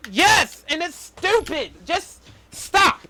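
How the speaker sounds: high-pitched, fed-up outburst; real exasperation. Real, not acted. Fan fed up with a rip-off plot, exasperated. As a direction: let frustration push the pitch way up. Exasperated rant about the movie.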